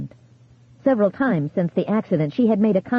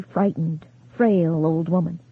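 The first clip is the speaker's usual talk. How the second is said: plain reading voice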